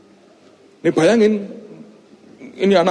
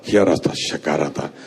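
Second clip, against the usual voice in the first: speaking in tongues; 14 semitones lower, 4 dB quieter than usual. Retold or imitated. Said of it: "Saya berdiri sambil bahasa roh".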